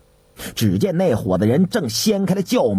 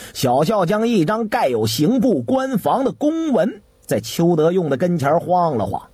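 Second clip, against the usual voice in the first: ordinary delivery, nothing marked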